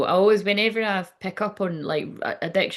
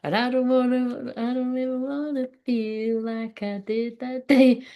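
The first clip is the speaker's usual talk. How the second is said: higher, slow and heartfelt; 6 semitones higher than usual. Acted. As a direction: let the words linger, wounded. Channelled message, slow far voice.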